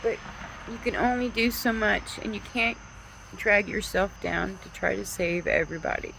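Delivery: holding back tears while urging people on; getting emotional. Real, not acted. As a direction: voice lifting high and thin with real emotion. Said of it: "Sorry, I'm getting emotional".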